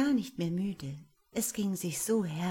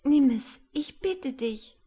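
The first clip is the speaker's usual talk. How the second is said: high, pleading and slowed, near tears; sobbing plea; 7 semitones higher, 8 dB louder than usual. Acted. Angel begs the wizard to take her heart, sobbing. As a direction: beg him, voice close to breaking. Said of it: "schluchzte das Englein".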